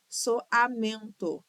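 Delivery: "Soamento" slow, in separate pieces with full final O; syllable by syllable. Shown as a demonstration. Teacher checking syllable division, "Soamento" syllable by syllable. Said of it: "divisão silábica de todas as palavras".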